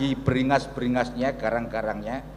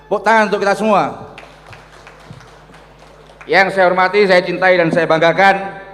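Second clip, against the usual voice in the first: loud, fast crowd hype; rally speech; 8 semitones higher, 10 dB louder than usual. Real, not acted. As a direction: pump up the hall. Emcee rousing the crowd.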